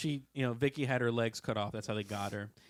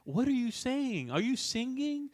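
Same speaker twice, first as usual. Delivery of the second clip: confused English donkey; 10 semitones higher than usual. Retold or imitated. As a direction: sound lost and puzzled, pitched way up. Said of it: "the English donkey is like".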